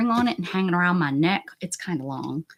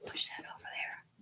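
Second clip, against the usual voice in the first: soft whisper while concentrating; hushed self-talk; 16 dB quieter, faster than usual. Real, not acted. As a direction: murmur instructions to yourself in a whisper. Careful balancing task.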